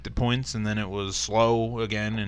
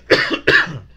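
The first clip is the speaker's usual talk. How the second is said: harsh unvoiced cough; real cough from a throat tickle; 4 semitones higher, 6 dB louder than usual. Real, not acted. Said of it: "sorry, I keep coughing".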